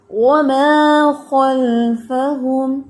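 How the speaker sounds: وَمَا خَلْفَهُمْ slow, full heavy خ; slow tajweed demo; heavy kha. Shown as a demonstration. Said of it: "خا heavy پڑھنا ہے".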